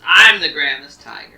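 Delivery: arguing tiger. Acted. Tiger argues with rivals over who's grandest, loud and boastful. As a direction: shout down the other tigers. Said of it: "they're arguing".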